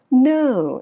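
"No" swung up high, then down; English up-down glide. Shown as a demonstration. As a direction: arch "No" up and let it fall. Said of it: "Nó sẽ là lên và xuống". (đọc "No" lên cao rồi xuống)

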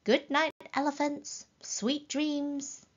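high, gentle bedtime voice; voice from the moon. A narrator's reading. The moon seems to bid elephants good night, high sing-out. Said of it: "A voice that seemed to come from the moon".

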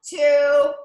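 shouted count; loud shout. Real, not acted. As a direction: call it out loud.

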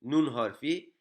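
usual speaking voice

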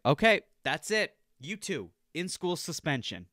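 high, loud final verdict; fed-up teacher. Retold or imitated. Teacher sentencing two students to suspension, fed-up shout. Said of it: "And then she's like".

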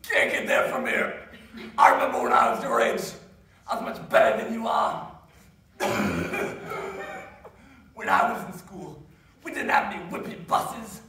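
put-on loud, cranky old Maine man; annoying old Maine guy. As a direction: grumble like a crusty old Mainer. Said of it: "Annoying old guy from Maine".

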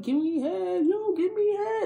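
high, needling imitation; mock insistent begging. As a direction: pester in a put-on high voice, pushing each repeat. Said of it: "he keeps insisting".

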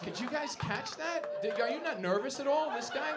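high, loud incredulous questions; loud disbelief. Real, not acted. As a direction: appeal to the whole room, astonished. Incredulous at audience member's move.

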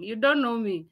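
ordinary talk

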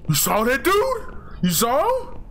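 high, incredulous question; surprised. Retold or imitated. Acting a surprised viewer.

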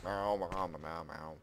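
low, casual mock meows; imitated meowing. Player answering the game's kitties, low playful meowing. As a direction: meow back at cats, softening as it goes. Imitates the cats just heard.

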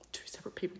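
whispering the repeat; whispered. Real, not acted. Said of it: "repeated emphasis".

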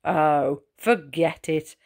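a hint of a teasing older brother; mocking brother. A narrator's reading. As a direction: wave him off like a big brother. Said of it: "his brothers were laughing out loud".

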